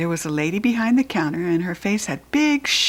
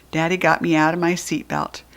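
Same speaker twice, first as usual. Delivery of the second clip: usual speaking voice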